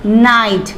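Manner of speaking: night drawn out very slowly, gh silent; very slow night with the gh dropped; slow demo. Shown as a demonstration. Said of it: "N I जी H T".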